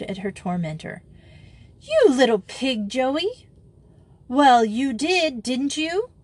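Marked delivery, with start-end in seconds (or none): 1.8-3.5 high, loud indignant outburst; furious. A narrator's reading. Grisel rounding on Joey, furious. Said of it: "crimson and furious". 4.3-6.1 high, drawn-out teasing comeback; taunting. A narrator's reading. Joey goading furious Grisel, taunting.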